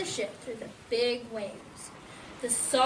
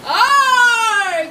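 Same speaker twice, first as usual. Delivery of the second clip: long, loud, high pirate yell; drawn-out hollered "arr"; crew's yell; 9 semitones higher, 16 dB louder than usual. A narrator's reading. Pirate crew hit by the wave, loud drawn-out yell. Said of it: "yells the crew".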